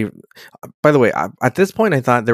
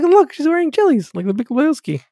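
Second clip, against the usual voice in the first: high, excited pointing-out voice; excited squeal. Retold or imitated. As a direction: pipe up gleefully about the shoes. Own excited remark, quoted.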